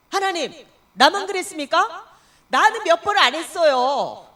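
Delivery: the deaconess protesting to God, loud and indignant. Retold or imitated. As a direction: argue back at God, offended. Arguing with God over fruit.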